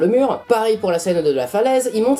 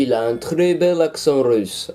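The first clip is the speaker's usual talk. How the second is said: put-on slow, lower Russian-accented French; mock Russian accent; 4 semitones lower, slower than usual. YouTuber praising the villain's accent, mock Russian accent. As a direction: put on a thick Russian accent, slowed right down. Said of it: "je sais faire l'accent russe".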